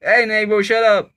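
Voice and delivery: sharp loud yell next door; yelling at neighbor. Real, not acted. Shouting at a noisy neighbor, loud yell. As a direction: tell the neighbor to shut up, loud.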